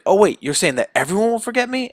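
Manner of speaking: quick, high-pitched mock disbelief; alarmed question. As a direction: act out sudden worry, rising fast. Peter alarmed by what spell does.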